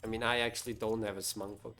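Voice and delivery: ordinary delivery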